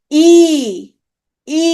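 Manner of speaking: ই held long as a pure front vowel; tongue forward. Shown as a demonstration. Teacher asking students to notice the tongue, long ই. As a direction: sustain ই with the tongue pushed forward. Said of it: "জিভ একটু সামনের দিকে".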